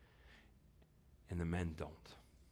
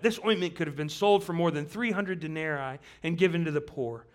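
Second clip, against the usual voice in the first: louder and quick, driving through the passage; scripture reading; 5 semitones higher, 7 dB louder than usual. Acted. Preacher reading Mark fourteen to the church, loud and quick. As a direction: read the Gospel like a story unfolding.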